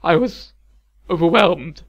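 measured, strained excuse; careful apology. A narrator's reading. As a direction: choose the last word carefully. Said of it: "he had carefully phrased his apology".

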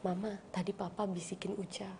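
put-on whispered child quote. Little son tells mama what papa whispered, whispering. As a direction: breathe it like a child's secret.

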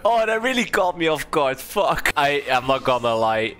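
ordinary talk, nothing marked

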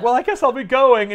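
high loud huff; huffy Mary Jane. Retold or imitated. Mary Jane leaving in a hammed-up huff. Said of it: "she's like".

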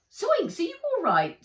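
high, loud worried call; alarmed check on her friend; alarmed Nat. Acted. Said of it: "said Nat".

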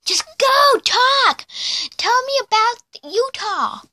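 high excited yelling; shouting. Real, not acted. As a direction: yell at her to talk. Urging friend to talk.

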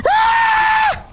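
very high, drawn-out scream; startled scream. Real, not acted. Streamer spooked by friend's creepy voice, high scream. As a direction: scream high as if jump-scared.

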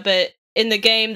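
ordinary talk, nothing marked

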